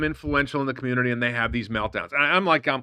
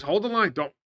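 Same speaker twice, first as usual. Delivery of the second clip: higher-pitched mock exhortation; imitating Barry; 6 semitones higher than usual. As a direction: quote the advice in a raised, mocking call. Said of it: "And, and Barry says".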